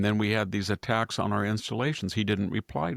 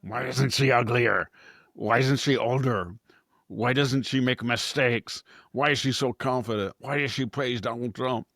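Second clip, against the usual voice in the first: higher, mock outraged whining; mock indignant leftists; 3 semitones higher than usual. Mocking left-wing critics of Carolyn Leavitt, a mild indignant whine. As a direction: voice their baffled envy mockingly. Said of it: "faces of the left-wing people like".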